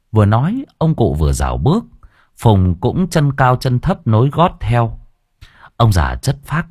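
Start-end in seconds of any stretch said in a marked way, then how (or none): none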